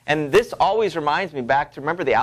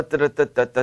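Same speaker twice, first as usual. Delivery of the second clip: ordinary talk, nothing marked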